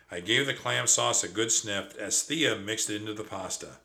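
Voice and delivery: plain reading voice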